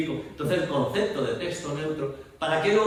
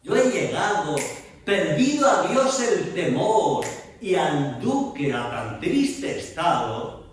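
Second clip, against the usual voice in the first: slow, louder verse line carried on to its end; sustained verse; 3 semitones higher, 4 dB louder, slower than usual. Shown as a demonstration. Teacher reciting verse to show the sustained pause. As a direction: carry the verse forward without letting it drop. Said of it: "se suspende la acción, se sostiene".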